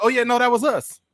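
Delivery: high-pitched, loud mock confession; mocking quote of someone claiming responsibility; Al Qaeda claiming credit. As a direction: jump up in pitch to eagerly take the blame. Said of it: "Al Qaeda's like".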